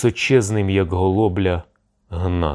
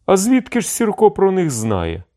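very high-pitched puzzlement; astonished question; 12 semitones higher than usual. A narrator's reading. Envoys wondering how the chieftain knows, astonished. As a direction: ask it high, in open surprise. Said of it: "здивувалися Чадуєві Щоголів".

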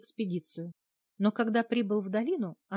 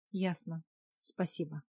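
soft, distant thanks; detached; 2 semitones lower, 6 dB quieter than usual. A narrator's reading. Wife thanks her husband while looking ahead, detached. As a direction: say it absently, voice dropped. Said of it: "поблагодарила отрешённо".

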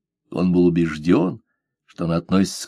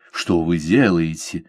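startled exclamation; 3 semitones lower than usual. A narrator's reading. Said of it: "воскликнул он".

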